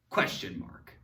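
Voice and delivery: usual speaking voice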